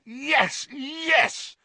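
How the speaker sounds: high, jubilant cries; excited yell. A narrator's reading. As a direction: cheer it as your friend wins. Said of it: "Ron yelled".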